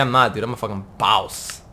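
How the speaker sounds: ordinary talk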